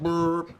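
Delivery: short puttering engine sound; motor noise. Acted. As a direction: imitate a cart pulling up. Imitating cart pulling up.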